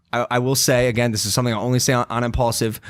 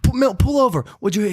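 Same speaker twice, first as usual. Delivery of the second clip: high, urgent retold panic; panicked demand; 8 semitones higher than usual. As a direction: push the driver to pull over. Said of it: "I'm like".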